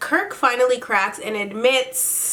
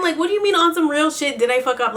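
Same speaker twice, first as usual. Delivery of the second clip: fast, high-pitched disbelief; mock incredulous; 6 semitones higher, 3 dB louder, faster than usual. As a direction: throw his words back at him quickly. Quotes him back in disbelief.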